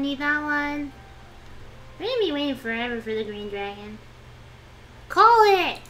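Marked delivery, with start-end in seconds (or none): 5.1-5.8 loud, high call; urgent shout. Real, not acted. Player urging others to call the tile, urgent shout. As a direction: shout it impatiently at the table.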